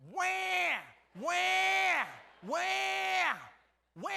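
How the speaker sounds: high, loud baby wail imitation; hammed-up crying baby; mock baby wail. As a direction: shout wah like a bawling infant. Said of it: "It's like that".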